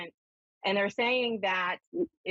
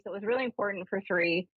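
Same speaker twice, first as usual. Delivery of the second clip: ordinary talk, nothing marked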